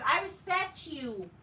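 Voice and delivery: mild sneering imitation; mocking wrestler quote. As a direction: parody her earnest speech. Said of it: "The fat whale bitch says".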